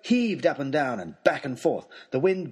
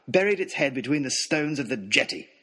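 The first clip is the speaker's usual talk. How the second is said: plain reading voice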